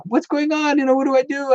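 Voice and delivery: high, strained voice of retold panic; high-pitched panicky mock of himself; panicking. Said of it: "I'm just panicking".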